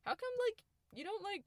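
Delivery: high-pitched mock mom voice; imitated mom. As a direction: ask it high, like a puzzled mom. Voicing mom's naive question.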